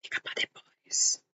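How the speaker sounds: breathy whisper, barely audible; whispered aside. Real, not acted. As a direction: drop to a secret whisper to yourself. Aside while waiting for viewers.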